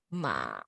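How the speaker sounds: MA dipped low, then up a bit; dipping third tone. Shown as a demonstration. Teacher modelling the third tone, down then up. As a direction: scoop MA down and back up. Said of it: "let's try all four together".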